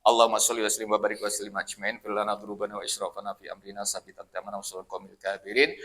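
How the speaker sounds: soft, quick murmured Arabic prayer; closing prayer. Acted. Preacher closing the sermon with a hushed, quick prayer.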